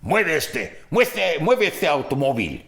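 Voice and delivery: high, loud barked order to move the car; policeman's order. Retold or imitated. Italian cop orders him to move the van, loudly. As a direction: bark it like a stern cop. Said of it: "And the guy goes".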